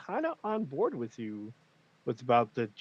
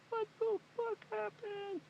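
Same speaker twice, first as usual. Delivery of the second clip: very soft, high-pitched disbelief; tiny high voice of mock shock; stunned hushed reaction; 10 dB quieter than usual. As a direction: act out a stunned viewer, barely audible. Acting out stunned viewer reaction.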